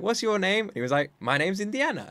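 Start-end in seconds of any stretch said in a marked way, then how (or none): 0.0-0.7 put-on little boy. Son voiced in story. 1.2-2.1 put-on little boy. Son voiced in story.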